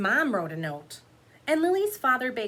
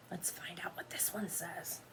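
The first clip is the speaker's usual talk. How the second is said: whisper; 3 semitones lower, 13 dB quieter than usual. Real, not acted. Teacher whispering to children before reading the page. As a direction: whisper it like a secret.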